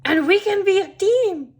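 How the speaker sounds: high, loud, jubilant exclamation; excited shout. A narrator's reading. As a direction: cry it out to a new teammate. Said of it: "Grumpy Todd shouts".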